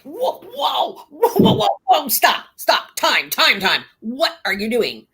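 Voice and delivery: high-pitched retold whoa, stop; alarmed protest. Mock patient halting a student who lifted their shirt, alarmed protest. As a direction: imitate yourself frantically calling a time-out.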